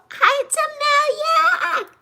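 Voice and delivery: very high, drawn-out squeaky hello; excited greeting. Real, not acted. Streamer greets Tamelia in chat, high excited squeak. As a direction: sing out a slow, squealed hello.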